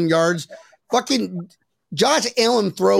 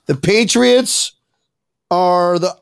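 slow, halting, stuck mid-sentence; forced recital; 3 dB louder, slower than usual. Acted. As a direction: drag the words out as if made to say them. Acting out being made to say it.

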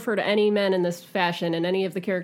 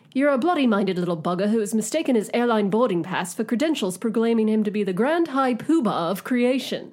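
put-on British accent; hammed-up British accent; 2 semitones higher than usual. Shown as a demonstration. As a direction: read it as an amateur Brit impression. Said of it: "I should be doing this in a British accent".

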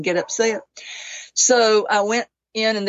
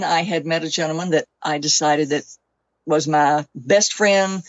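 ordinary talk, nothing marked

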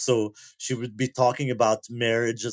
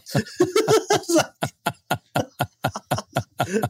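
high laugh; 11 semitones higher than usual. Real, not acted. Big laugh at the story.

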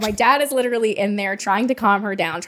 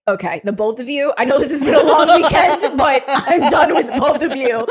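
dad laughing, exasperated; 5 semitones higher, 5 dB louder than usual. Retold or imitated. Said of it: "he's like".

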